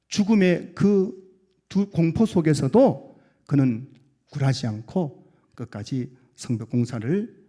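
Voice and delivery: ordinary delivery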